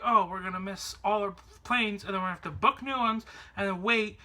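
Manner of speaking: ordinary talk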